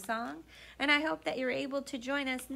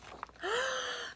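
gasp. Acted. Storyteller gasps at giant.

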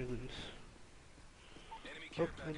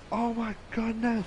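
high, loud drawn-out amazement; astonished; 8 semitones higher, 6 dB louder than usual. Real, not acted. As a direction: gasp it out slowly in awe. Stealth bomber strikes.

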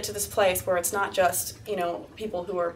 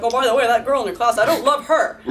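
laughing, louder mock God voice; 3 semitones higher, 7 dB louder than usual. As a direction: play God as a petty gossip, giggling. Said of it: "He's like".

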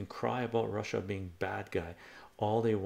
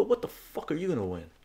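higher-pitched heated retort; going off on them; 6 semitones higher, 4 dB louder, faster than usual. Retold or imitated. Arguing with pub yahoos about the war, indignant. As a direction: reenact an indignant challenge. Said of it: "I fucking went off on them".

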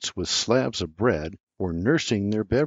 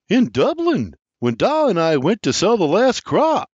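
put-on higher, louder young man's voice; William, voiced higher and louder; young William answering; 10 semitones higher, 6 dB louder than usual. A narrator's reading. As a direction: answer eagerly, pitch up. William answering his uncle.

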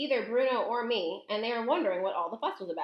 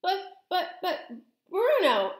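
high, flustered stammer building louder; 10 semitones higher, 5 dB louder, slower than usual. A narrator's reading. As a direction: stumble over your words in shock. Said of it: "stammered Mister Jenkins again".

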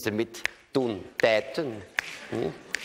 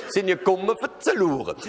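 mock Rhenish; 9 semitones higher than usual. Shown as a demonstration. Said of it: "das ist doch der Spion oder was?".

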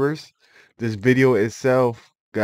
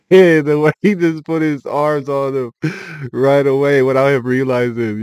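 laughing; 4 semitones higher, 6 dB louder than usual. Real, not acted. Reacting to the arrest clip.

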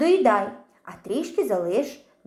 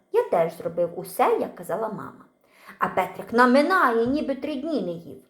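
usual speaking voice

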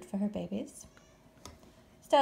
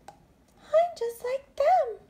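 put-on squeaky baby bat; 6 dB louder, much slower than usual. Bat's thought quoted.

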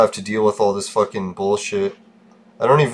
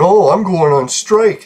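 higher, louder, drawn-out mock threat; mocking imitation; 7 semitones higher, 5 dB louder, slower than usual. Roommate threatening to stop work, mockingly imitated. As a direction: mimic the roommate's threat, slow and high.

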